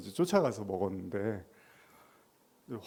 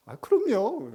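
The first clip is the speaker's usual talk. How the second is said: eager reply; 11 semitones higher, 5 dB louder, slower than usual. Acted. Congregant answering.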